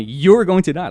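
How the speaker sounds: loud, high imitation of a demon; retold threat, hurled out forcefully; demon's voice. Man imitating the demon telling him he'll die, loud and forceful. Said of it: "a demon or, like, telling me".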